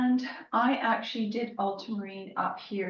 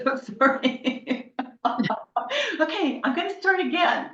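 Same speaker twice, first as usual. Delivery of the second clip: laughing; 9 semitones higher, 8 dB louder than usual. Real, not acted. Laughing about the cat.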